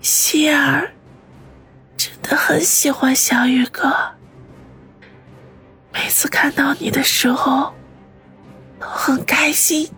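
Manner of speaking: weak, breathy whisper; barely any strength left; last breath. Acted. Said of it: "用尽最后一丝力气说".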